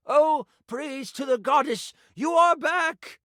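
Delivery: high, slow relieved cry of praise; excited priest. Acted. Priest welcoming the returning hero, high relieved cry. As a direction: greet the hero with joyful relief.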